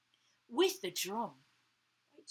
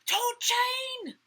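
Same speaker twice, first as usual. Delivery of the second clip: high, loud, drawn-out call; children crying out; 13 semitones higher, 12 dB louder than usual. A narrator's reading. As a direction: call it out like an excited class. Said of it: "they cried out".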